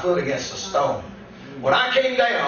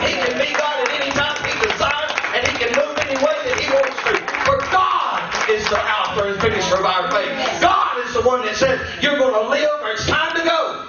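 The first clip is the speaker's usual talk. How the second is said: full-force preaching; 9 semitones higher than usual. Acted. Preacher testifies to his congregation, shouting in full fervor. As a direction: drive it home to the congregation.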